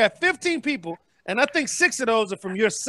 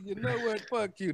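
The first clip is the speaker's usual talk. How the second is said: much softer, lower deadpan; quiet mock announcement; 5 semitones lower, 10 dB quieter than usual. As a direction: say it like a show title, hushed. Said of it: "That's the name of my next show".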